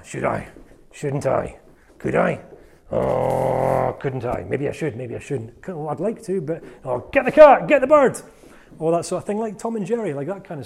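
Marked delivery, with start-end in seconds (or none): 0.0-7.1 inner debating voices. Retold or imitated. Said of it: "the little devil on one shoulder and the little angel". 7.1-8.2 higher, louder cartoon yelp; excited shout. Cartoon cat chasing bird, excited higher shout.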